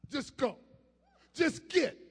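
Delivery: loud preacher ordering out a spirit; commanding preaching. Real, not acted.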